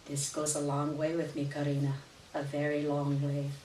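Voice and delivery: a lightly voiced aunt, softly grateful; tender. A narrator's reading. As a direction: say it low and soft, moved. Aunt's tearful grateful line.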